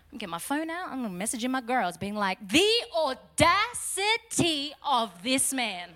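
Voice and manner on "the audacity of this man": slow, higher-pitched mock outrage; millennial indignation. Millennial messaging her girls about Jesus, mock indignation. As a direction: spell out the offence syllable by syllable. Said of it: "like, a millennial".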